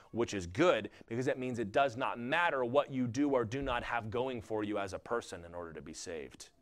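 ordinary talk

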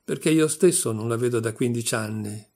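ordinary delivery

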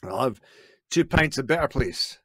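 hammy put-on Scottish accent; badly faked Scottish accent ordering beer; fake Scottish accent. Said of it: "I just faked my biggest Scottish accent".

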